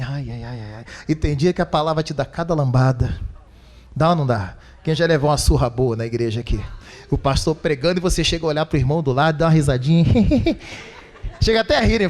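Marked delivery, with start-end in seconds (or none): 0.0-0.8 soft, low ai ai ai; mock wincing moan. Pastor acts a believer stung by the sermon, soft wincing moan. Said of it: "Você fala assim". 10.1-10.8 giggle. Retold or imitated. Congregant giggling in pew.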